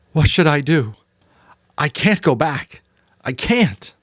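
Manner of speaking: put-on a boy near tears, crying out; upset boy, high and raw; Jonas, anguished. A narrator's reading. Jonas despairing.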